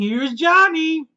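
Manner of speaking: loud, high, slow menacing call; Here's Johnny quote. Acted. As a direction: burst in like a madman. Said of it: "The Shining".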